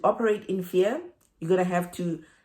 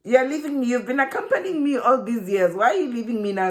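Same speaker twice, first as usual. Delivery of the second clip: high, indignant pleading; acted-out hurt disbelief; mock dismayed protest; 5 semitones higher, 3 dB louder than usual. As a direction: protest high as if being abandoned. Acted protest at being abandoned.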